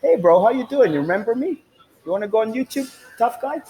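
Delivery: high, mocking guard voice; mocking security guard. Security guard taunting him, high mocking voice. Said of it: "and the security guard".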